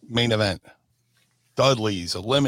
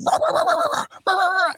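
very high yapping guard-dog barks; dog barking; 4 dB louder, faster than usual. Retold or imitated. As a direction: bark like a riled-up guard dog. Imitating barking guard dogs.

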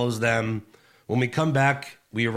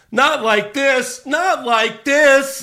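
loud drawn-out yell; slow anguished commentator yell; 8 dB louder, much slower than usual. Retold or imitated. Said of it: "Vic Joseph yells".

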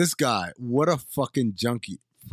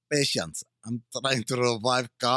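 put-on broken English accent; 3 semitones lower than usual. Cohost mimicking Travolta's Balkan accent. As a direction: lower mock Balkan accent on "revive car". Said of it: "that broken English".

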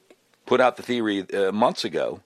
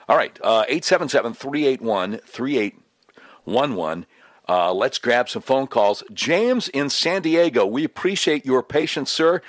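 radio host announcer; 5 semitones higher, 3 dB louder than usual. Acted. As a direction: announce the number and caller like a DJ. Host opens phone lines.

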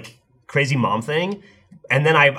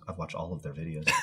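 quiet; 6 semitones lower, 13 dB quieter than usual. Retold or imitated. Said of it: "And she goes".

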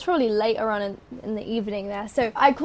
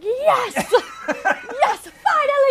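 high, laughing mock cheer; triumphant cheer; 12 semitones higher, much slower than usual. Mum throwing down the mop at nomination news, jubilant cry. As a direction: whoop it like an overjoyed mum.

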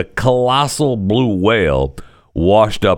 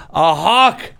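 normal talking voice

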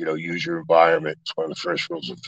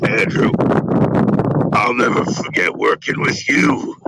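imitating Pacino; 4 semitones higher, 5 dB louder than usual. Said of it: "He goes".

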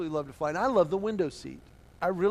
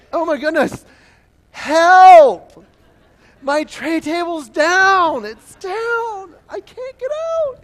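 high shrieking panicked passenger; mock panic; 11 semitones higher, 13 dB louder, much slower than usual. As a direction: squeal for help as if trapped.

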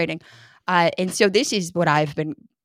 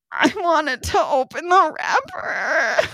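put-on sobbing child's wail; 7 semitones higher, much slower than usual. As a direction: wail it like a crying five-year-old. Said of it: "And she said".

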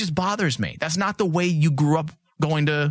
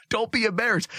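pitched up, laughing a little; teasing his son, raised pitch; playful high voice; 7 semitones higher than usual. Pretend. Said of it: "'Cause I'm like".

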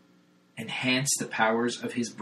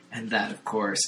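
ordinary talk, nothing marked

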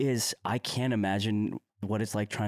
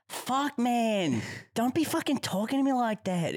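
high, hammed-up Aussie rant; mock angry Australian bloke; exaggerated Australian; 11 semitones higher than usual. Imitating a friend getting really Australian, hammed-up Aussie accent. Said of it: "he starts getting really Australian".

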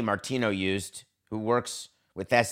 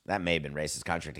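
ordinary talk

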